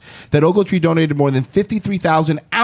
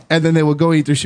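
ordinary talk, nothing marked

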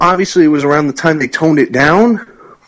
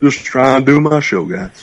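echoing the catchphrase in a put-on voice; J J Stoner voice. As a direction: repeat it in his voice, trailing off. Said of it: "in the J J Stoner voice".

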